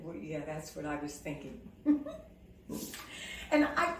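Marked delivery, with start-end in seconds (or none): 0.0-1.6 quiet. Retold or imitated. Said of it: "she said". 1.6-2.2 short high laugh; high giggle. Real, not acted. Laughing at the story.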